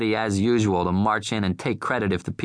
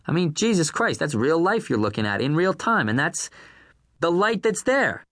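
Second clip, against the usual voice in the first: raised, incredulous outburst; exasperated rant; 9 semitones higher than usual. A narrator's reading. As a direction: lose patience and let it rip. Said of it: "Irv's voice rose".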